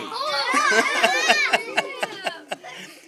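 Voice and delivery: loud high laugh. Real, not acted. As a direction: burst out laughing. Parent laughing loudly.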